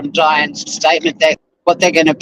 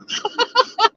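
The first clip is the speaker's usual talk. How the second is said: high laugh; 6 dB quieter than usual. Real, not acted. Laughs at own joke.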